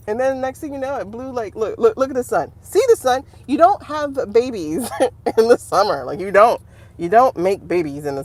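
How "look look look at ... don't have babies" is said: ordinary talk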